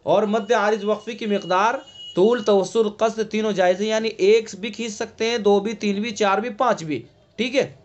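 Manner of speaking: normal talking voice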